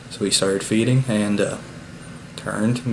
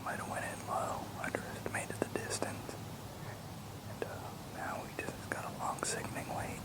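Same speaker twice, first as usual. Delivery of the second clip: hushed whisper, very soft and unvoiced; quiet whispered field update from a hunter; real whisper; 8 semitones higher, 17 dB quieter than usual. Real, not acted. Whispers in the field after shot.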